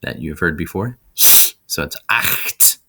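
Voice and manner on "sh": a harsh throaty hiss; throaty ch-sound demo; angry badger hiss. Shown as a demonstration. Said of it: "that angry badger noise".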